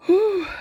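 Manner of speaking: high, loud "whew" of release; relieved puff after crying; composing exhale. Real, not acted. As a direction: blow out a big breath to steady yourself. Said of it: "Let me rein it in".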